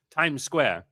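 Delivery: high mock New York accent; playful New York accent attempt; bad New York accent. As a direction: call it out like a pretend New Yorker. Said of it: "I can't do the accent, bro".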